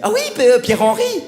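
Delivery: mock eureka, high and loud; triumphant guesser. Woman proudly guesses the wrong name from initials, acted triumph. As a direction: burst out as if you just cracked the riddle.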